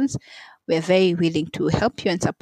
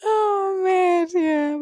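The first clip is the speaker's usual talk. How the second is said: nervous laughter; 13 semitones higher, much slower than usual. Real, not acted. Laughing fit after thanks.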